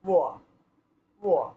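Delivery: ও আ said as one quick glide; wa with b in mind. Shown as a demonstration. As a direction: keep a hint of ব in ও আ. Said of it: "ও যুক্ত আ".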